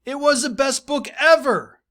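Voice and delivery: put-on bright eager kid reading his line; excited student. Teacher reading Tim's reply to the class, excited.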